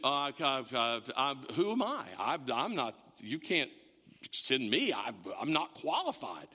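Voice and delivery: low, halting, self-doubting stutter; stammering excuse-maker. Acted. Man dodging God's ministry call, stammering low. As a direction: stumble over each excuse, low. Acting out Moses' excuses to God.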